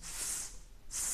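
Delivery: স as a clean dental s; স like English s; demo s sound. Shown as a demonstration. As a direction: hiss স against the teeth. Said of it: "এর উচ্চারণ হলো স".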